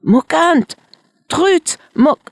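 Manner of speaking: high, loud calls cut off mid-name; shouting. Acted. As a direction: yell for the dogs and choke on the last one. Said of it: "Et le tzigane cria encore".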